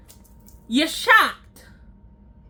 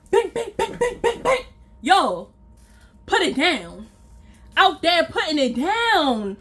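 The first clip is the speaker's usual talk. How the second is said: usual speaking voice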